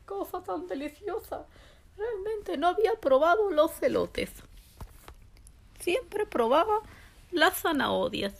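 ordinary delivery

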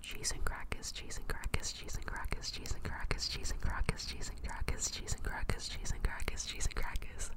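soft even whispered repetition; relaxing ASMR repetition. Shown as a demonstration. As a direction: loop the words gently to soothe. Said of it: "Was that better?".

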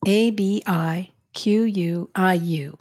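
slow, letter by letter, barely voiced; spelled out letter by letter. A narrator's reading. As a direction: spell each letter carefully and slowly. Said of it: "Spell that for me".

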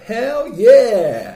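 loud, high, drawn-out cheer; excited reply. Retold or imitated. Reading out the neighbour's reply, elated shout. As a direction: belt it out like an elated acceptance. Enthusiastic reply read out from texts.

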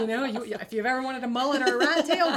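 laughing. Real, not acted. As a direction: giggle while you suggest it. Joking about mullets after chemo.

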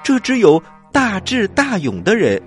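usual speaking voice

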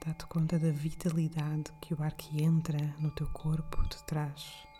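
calm guide with long pauses between breaths; guided meditation. Acted. (meditação guiada, voz suave e lenta)

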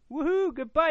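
high, loud woohoo; excited cheer. Real, not acted. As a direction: cheer it out happily. Cheering a podcast goodbye.

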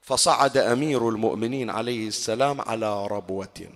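ordinary talk

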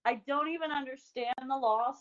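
usual speaking voice

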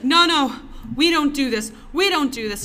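insist, wound up and fervent; upset religious woman protesting to the manager; insistent refusal. Retold or imitated. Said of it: "she's like".

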